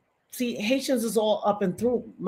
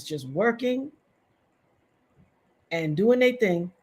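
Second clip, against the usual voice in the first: ordinary talk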